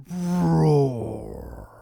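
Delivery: long, loud furious roar; villain roar. Acted. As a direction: let out a raging bellow. Villain raging at intruders.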